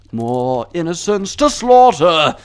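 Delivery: loud, slow, drawn-out war cry; mock battle cry. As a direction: cry it out with hammed-up bloodlust. Mock villainous battle cry in game.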